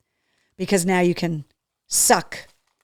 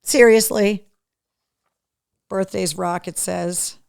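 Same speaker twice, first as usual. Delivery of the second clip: normal talking voice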